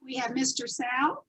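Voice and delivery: plain reading voice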